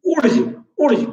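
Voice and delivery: ऊर्ज as short "oorj", no V or L; correct Wurtz pronunciation. Shown as a demonstration. Teacher correcting students' pronunciation, ऊर्ज said as "oorj". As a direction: say ऊर्ज alone, opening on a long "oo". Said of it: "उच्चारण है क्या है".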